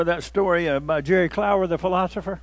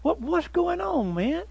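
higher, soft puzzled neighbor voice; neighbors asking; 10 semitones higher, 4 dB quieter, slower than usual. Retold or imitated. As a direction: ask it high and bewildered. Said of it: "overtook him and said".

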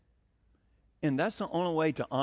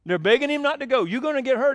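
rising preaching intensity; 7 semitones higher, 7 dB louder than usual. Real, not acted.

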